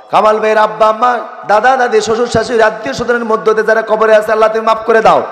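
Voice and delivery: high, quick-fire chanted supplication; urgent melodic prayer list; fervent prayer. Real, not acted.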